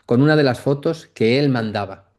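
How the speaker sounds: ordinary talk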